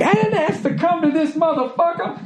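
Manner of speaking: very high-pitched, indignant comic voice; Richard Pryor. Retold or imitated. Comic quoting a backroom complaint to a wife, high indignation. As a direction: squeal the protest, upset and indignant. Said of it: "that Richard Pryor bit".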